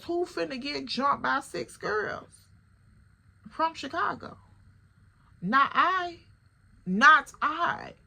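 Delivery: with sass; incredulous. Real, not acted. Narrator telling listeners she won't get jumped, sassy. Mock outrage at odds.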